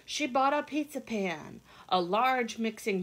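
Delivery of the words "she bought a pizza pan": ordinary delivery, nothing marked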